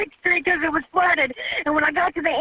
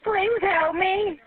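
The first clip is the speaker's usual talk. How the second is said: desperate pleading; 3 semitones higher, slower than usual. Real, not acted. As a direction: drag each word out, pleading for rescue. Caller pleading as water rises.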